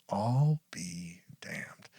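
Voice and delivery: very slow, near-whispered amazement; imitating dad's hushed disbelief; retold stunned whisper. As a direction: breathe out each word slowly, as if dumbfounded. Retells dad repeating it, stunned.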